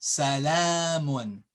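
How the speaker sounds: salamun, slow with a long la; long vowel. Shown as a demonstration. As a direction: stretch the middle a of salamun. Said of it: "See the alif".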